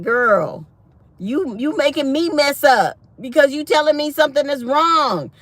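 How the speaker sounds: loud, high exasperated comeback; retold scolding.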